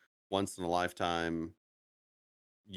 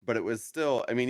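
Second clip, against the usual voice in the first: normal talking voice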